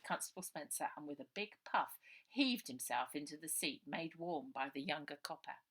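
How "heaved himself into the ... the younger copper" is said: plain reading voice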